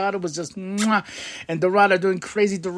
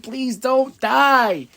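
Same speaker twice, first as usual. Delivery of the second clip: higher, very slow please don't die; pleading; 8 semitones higher, much slower than usual. Retold or imitated. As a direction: beg slowly for his safety. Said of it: "moments where I'm like".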